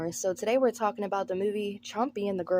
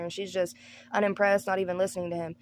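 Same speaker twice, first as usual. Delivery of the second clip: usual speaking voice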